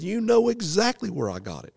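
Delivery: high, loud accusing retort; angry accusation. Retold or imitated. Farmer accuses the preacher, high and loud. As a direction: throw it back at him.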